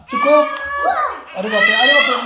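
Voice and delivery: high slow cry of alarm; alarmed surprise. Real, not acted. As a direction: react as if she just lunged. Child suddenly hitting.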